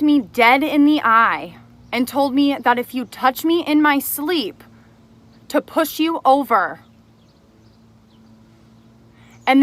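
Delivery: loud, heated scolding; furious at a lying former friend; angry rant. Real, not acted. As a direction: let the anger boil over. Ranting at the liar.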